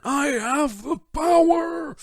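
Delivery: high, slow, loud heroic cry; He-Man. Pretend. Listing game characters, a high slow heroic He-Man cry. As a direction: proclaim it like a cartoon hero raising his sword. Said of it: "So you've got He-Man".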